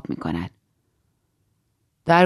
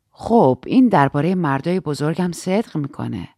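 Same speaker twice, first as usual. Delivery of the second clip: usual speaking voice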